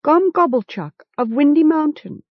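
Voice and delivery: high-pitched, mildly defiant reply; guarded self-introduction. A narrator's reading. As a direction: state your name, standing your ground. Captive forces himself to answer captors.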